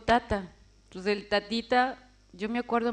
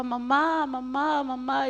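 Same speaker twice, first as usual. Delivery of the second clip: retelling her daughter's frantic cries, higher-voiced; child crying for mother; 4 semitones higher, slower than usual. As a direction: call out for your mother, desperate.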